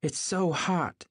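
high, drawn-out complaint; complaining. A narrator's reading. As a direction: draw it out slowly in a high whine. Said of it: "she complained".

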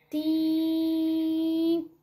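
one high, sustained ting; long ringing "tiiim"; long "tim" sound. Shown as a demonstration. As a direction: hold "tim" out on a steady note. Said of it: "o som longo é aquele que fica".

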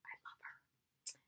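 barely audible, whispered confession of love; whispered aside. Real, not acted. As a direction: drop to a near-silent whisper. Aside about a favorite heroine.

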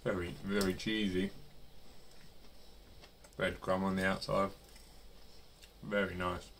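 speaking with food in mouth; chewing while talking. Real, not acted. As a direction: mouth partly full. Seen 0: Bites food at -2, jaw and lips look busy while talking.

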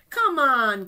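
loud taunting shout; Goliath shouting at the boy, loud and mocking; giant's yell. A narrator's reading. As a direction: bellow it like an angry giant. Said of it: "Goliath yelled".

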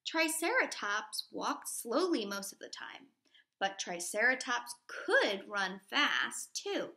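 normal talking voice

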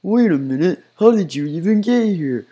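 mock surprise. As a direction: jump up in pitch in cartoon shock. Joey Drew surprised.

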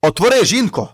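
loud call through the window; shouting. Acted. Husband shouts to his wife under the window, loud. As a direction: call out loud to wake her. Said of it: "кричить".

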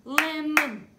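"Lemon" beaten out loudly as "lem-mon"; clapped syllables. Shown as a demonstration. Teacher clapping syllables, "Lemon" as two loud beats. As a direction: give "Lemon" two equal, punched beats. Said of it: "Two syllables in lemon".

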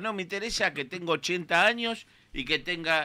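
usual speaking voice

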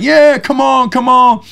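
high, hyped re-enacted hollering; rally the crowd like you're pumped; pumped-up cheer. Friends throwing their hands up after the stunt, pumped cheering. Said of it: "we were pumped".

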